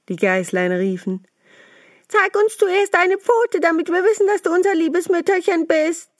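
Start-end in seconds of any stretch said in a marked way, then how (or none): 2.1-6.1 put-on much higher, louder kid voices refusing; little goats shouting no through the door; defiant goat kids. Kids refusing to open for the wolf, high and loud.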